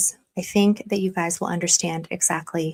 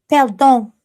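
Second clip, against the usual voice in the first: higher, louder, clumsy French "Pardon"; bad French accent; 4 semitones higher, 6 dB louder than usual. Shown as a demonstration. Streamer practising sorry for chat, bad French accent. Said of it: "That was bad".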